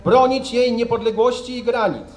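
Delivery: solemn, measured oath dictation; formal oath reading. Real, not acted. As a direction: read each oath line out for the ranks. Officer reading the oath line.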